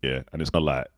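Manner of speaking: casual South East London street talk; South East London accent. Shown as a demonstration. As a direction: slip into chilled South East London speech. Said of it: "I can speak like South East London".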